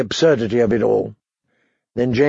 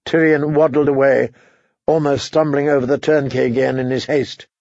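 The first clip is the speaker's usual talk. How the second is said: plain reading voice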